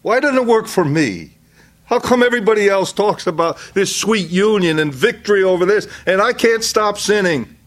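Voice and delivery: high, aggrieved plea of a believer; frustrated churchgoer venting, high and pleading. Retold or imitated. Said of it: "And they're saying".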